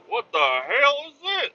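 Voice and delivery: high-pitched, exasperated boss; freaking out. Retold or imitated. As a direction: imitate a boss freaking out, voice shooting way up. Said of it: "he's freaking out on me".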